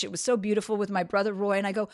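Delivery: ordinary talk, nothing marked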